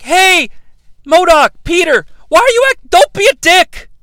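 high, loud mock pleading shout; hammed-up high-pitched appeal; calling out. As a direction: imitate a girl yelling at a villain. Imitates Cassie calling out to villain.

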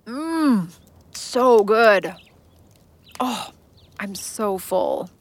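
talking through a mouthful of ravioli; mouth full. Acted. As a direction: speak around a big bite of food. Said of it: "with her mouth full of ravioli".

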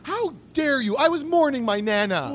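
mock outrage. Mock offended outburst.

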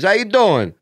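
high, loud Dice-style hello. Retold or imitated. Comic imitating Dice in the dressing room. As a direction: bellow it like Dice. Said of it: "He goes".

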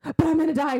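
mock panic. Trapped Feyre answering the voice in her head, mock panic. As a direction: imitate a trapped woman protesting. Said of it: "She's like".

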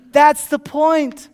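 loud, high, slow proclamation; emphatic repeat. Real, not acted. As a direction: hammer the point home to the congregation.

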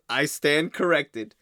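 slow, dignified mock concession; Laszlo delivery impression. Fan quoting Laszlo's concession, slow and dignified. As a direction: concede with grand composure. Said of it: "And he goes".

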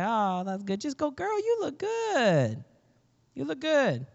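high-pitched, drawn-out mock gushing; forced gushing compliment. Praising your sister in her old jeans, forced gushing. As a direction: gush it in a put-on high voice.

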